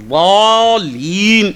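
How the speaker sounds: ضالین with the long alif stretched very slowly; drawn-out alif. Shown as a demonstration. Preacher demonstrating tajweed, ضالین with a long drawn alif. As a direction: hold the aa in ضالین long and full. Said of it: "الف کی آواز نکالتی ہے".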